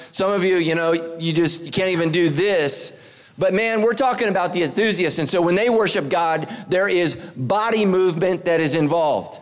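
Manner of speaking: ordinary delivery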